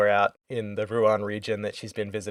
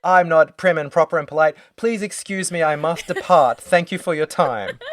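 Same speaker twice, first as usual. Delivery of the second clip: prim mock exit; 6 semitones higher, 5 dB louder than usual. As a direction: parody a too-proper teen storming out. Mocking Chloe's overblown exit.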